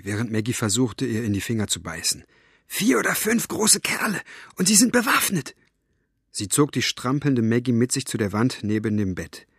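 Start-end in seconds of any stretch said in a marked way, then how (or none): 2.7-5.5 urgent high-pitched hiss. A narrator's reading. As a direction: hiss a warning under pressure. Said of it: "zischte sie". (eindringlich und hoch gezischt)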